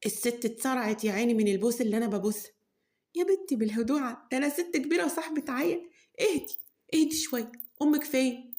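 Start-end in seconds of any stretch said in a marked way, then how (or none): 3.2-8.5 put-on elderly aunt. Old Khala Horeya calms hugging girl.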